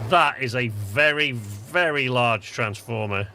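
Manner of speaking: drawn-out amazed delivery; slow and emphatic. Real, not acted. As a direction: stretch every word in awe.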